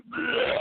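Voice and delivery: mock gag. Real, not acted. Mock vomiting sound.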